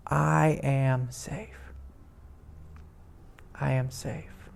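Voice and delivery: very slow, soft affirmation; quietly affirming his own safety; calm affirmation. Shown as a demonstration. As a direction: let each word settle, calm and safe. Zen-like experience of safety.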